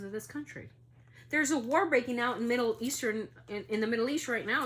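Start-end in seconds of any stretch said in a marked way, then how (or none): 1.3-4.6 higher and louder, alarmed; urgent, sounding the alarm; raised, worried announcement. Real, not acted. Alarmed news of war.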